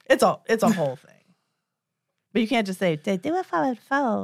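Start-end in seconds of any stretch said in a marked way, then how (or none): 3.0-4.2 a hint of a breezy yoga girl.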